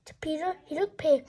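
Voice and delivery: ordinary delivery, nothing marked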